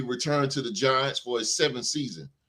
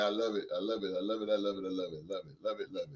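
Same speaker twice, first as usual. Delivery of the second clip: fading repetition; 6 semitones lower, 11 dB quieter, faster than usual. Real, not acted. Host savoring his love of basketball, fading softer. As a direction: repeat it, softer each time until it dies away.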